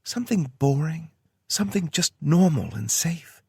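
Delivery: Tara voice. A narrator's reading. Tara's voice in story.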